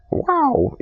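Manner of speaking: high, breathless gawking at the crowds; wide-eyed amazement. Retold or imitated. New arrival in the big city, gawking in amazement. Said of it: "I'm like".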